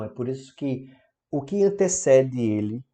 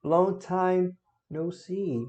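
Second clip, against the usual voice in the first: slow, drawn-out teacher model; slow model phrase; 6 semitones higher, much slower than usual. Shown as a demonstration. Teacher modeling the phrase slowly for students. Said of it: "Expressões para despedidas".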